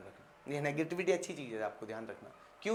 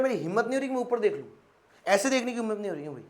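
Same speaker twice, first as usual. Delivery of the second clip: fast, louder nervous blurt; panicky rush; 4 semitones higher, 6 dB louder, much faster than usual. Acted. Student too scared to look. (घबराहट में तेज़ी से बोलना)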